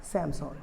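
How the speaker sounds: सैमसंग said "sam-sawn", falling; correct Korean pronunciation. Shown as a demonstration. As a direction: open aw vowel ending सैमसंग. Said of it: "इसका correct pronunciation है".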